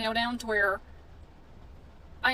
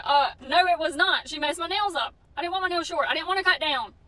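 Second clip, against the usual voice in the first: retold shouting back; 6 semitones higher, 7 dB louder than usual. Shouting back at salon owner.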